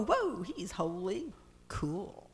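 offhand, quiet and unimpressed; casual mock shrug. Preacher mocking a lukewarm reaction, casually offhand. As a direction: toss it off like it's no big deal. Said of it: "They don't just go".

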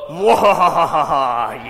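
slow, loud villain cackle; evil laugh. Shown as a demonstration. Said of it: "let's hear your evil laugh".

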